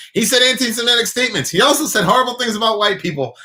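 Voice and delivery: high, fast sarcastic mimic of outraged people; high-pitched mocking impression of pearl-clutchers; mocking outrage. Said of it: "everybody's like".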